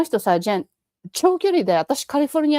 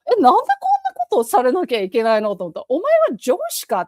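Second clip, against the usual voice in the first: indignant inner rant; 3 semitones higher, 3 dB louder, much faster than usual. Retold or imitated. 上司気取りの元彼に心の中で憤慨して、憤った声で. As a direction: fire back as if to a bossy manager.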